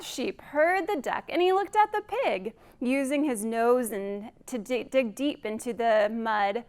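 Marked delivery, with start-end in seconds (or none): none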